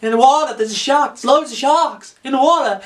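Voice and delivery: high, frantic alarm; over-the-top frightened beachgoer; mock panic. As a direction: babble a hammed-up warning to the whole beach.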